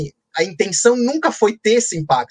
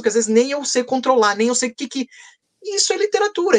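normal talking voice

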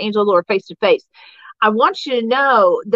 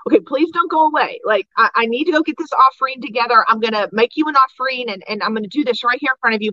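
fast, eager rush of words; hurried pleading; faster than usual. Retold or imitated. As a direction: beg him to stay, tumbling out quickly. Gideon pleads with the angel.

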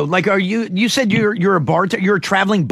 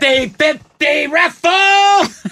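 vendor call; 8 semitones higher, 4 dB louder, much slower than usual. Retold or imitated. Imitating stadium vendor calls.

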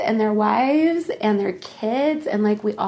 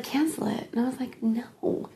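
hushed; 10 dB quieter than usual. Retold or imitated. Acted low-key exchange about cancelling.

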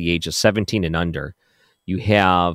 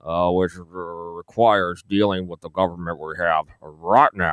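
slurred, halting politician impersonation; Mitch McConnell; slower than usual. Shown as a demonstration. Radio host echoing the senator's clip to prove himself, slurred halting impression. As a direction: stumble slowly into the senator's slurred speech. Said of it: "my Mitch McConnell impersonation".

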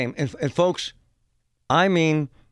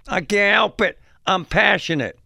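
high-pitched, raised-voice mock protest; defensive screaming father; 6 semitones higher, 4 dB louder than usual. Father answering those asking him to shut up, mock indignant outburst. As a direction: imitate a worked-up sports dad defending himself. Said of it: "The one guy says this".

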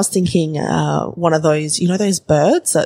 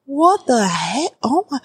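incredulous; 8 semitones higher than usual. Real, not acted. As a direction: stretch it out, pitched up in amazement. Reacting in disbelief to the story.

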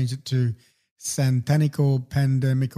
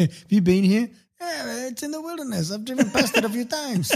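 laughing; 8 semitones higher than usual. Real, not acted. Laughing while talking.

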